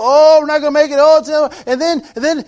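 high, loud mock despair; mock panic. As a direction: cry out like all is lost. Quoting panicked people.

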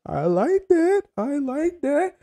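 high drawn-out pleased tone; delighted praise. Real, not acted. Streamer to Juan in chat, high delighted praise. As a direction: savor the compliment.

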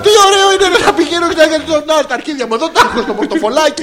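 high, fast, laughing protest; laughing outburst. Real, not acted. Mocking a friend's waxing plan, laughing. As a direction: blurt it out through laughter.

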